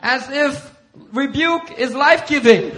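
high, loud, drawn-out sarcasm; mock incredulity. Pastor mocking his rebukes as life-giving, sarcastic. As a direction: scoff slowly and emphatically. Mocks own idea, then corrects it.

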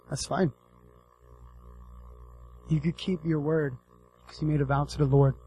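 softly voiced resigned daughter; quiet acceptance. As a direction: say it low and soft, giving in. Voices the daughter accepting her fate.

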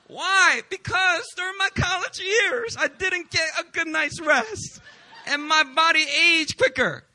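high, loud, slow with laughter; hammed-up explanation. Real, not acted. Explaining to viewers why he looks older, loud and laughing. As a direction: ham up the excuse, laughing.

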